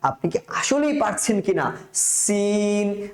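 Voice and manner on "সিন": সিন with a long, thin hissed s, drawn out slowly; hissing sin. Shown as a demonstration. Teacher models the letter for students, slow hissing সিন. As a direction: hold সিন long, s like escaping air. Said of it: "সেই আওয়াজের মতন করে উচ্চারণ করবেন".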